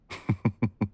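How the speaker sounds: a short teasing laugh; playful laugh. Acted. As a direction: laugh as if the game delights you. Delighted by the game.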